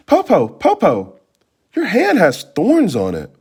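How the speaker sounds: put-on high, startled girl's voice; a little girl noticing something odd; alarmed child. Girl tells grandmother about her foot, startled.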